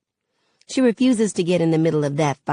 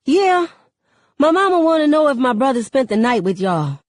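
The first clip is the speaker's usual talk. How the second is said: put-on high, louder teen girl voice; sassy teen girl; 8 semitones higher, 5 dB louder than usual. A narrator's reading. Kenya asking Uncle Maverick for her mama, sassy teen girl. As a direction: pitch it up like a forthright girl.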